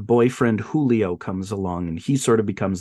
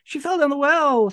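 put-on high-pitched kids raising the alarm; boys' mock scream. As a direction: mock-scream it like panicked boys, without full volume. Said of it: "the boys scream".

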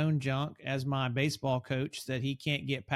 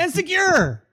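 high, loud teasing add-on; mock dramatic joke; 13 semitones higher, 10 dB louder than usual. Co-host teasing his partner's list of words with a jokey, loud, high finish. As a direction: toss it in as an over-the-top joke. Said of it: "I'm just joking".

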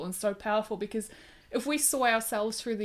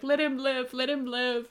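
imitated plea, pitched higher; higher-voiced retold begging; 5 semitones higher than usual. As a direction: plead for a life, retelling it. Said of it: "she's, like, begging him".

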